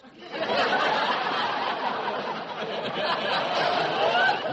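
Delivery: breathy burst of laughter; laughing. Real, not acted. Straight man laughs at Costello's pot roast joke. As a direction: laugh at the partner's gag.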